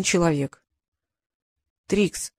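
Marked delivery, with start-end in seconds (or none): none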